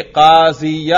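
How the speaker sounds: قاضیہ with an audible "-ya" ending; fatha pronounced aloud. Shown as a demonstration. Teacher letting students hear the nasb, fatha pronounced. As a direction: sound out the final "a" of قاضیہ clearly. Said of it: "ہم اس پر تلفظ کریں گے".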